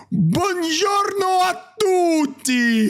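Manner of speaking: high, slow sing-song Italian greeting; hammed-up Italian accent, drawn out; mock Italian chef. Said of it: "écoutez-moi bien".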